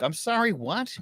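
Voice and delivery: mock-baffled, high-pitched question; retold bewildered reply. Baffled reply to shouting neighbor.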